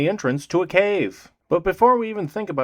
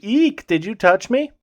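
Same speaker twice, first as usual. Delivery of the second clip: higher, lightly voiced startled girl; a hammed-up little shriek of offense; mock shrieking girl; 7 semitones higher than usual. Girl accusing the player in tall grass, mock startled. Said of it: "a girl who walks up to us and says".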